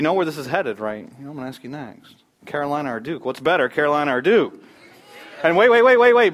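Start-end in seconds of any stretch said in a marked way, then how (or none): 5.4-6.3 loud, much higher repeated wait; calling over the room; urgent stop. Real, not acted. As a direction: halt the crowd before they answer. Stopping the crowd answering.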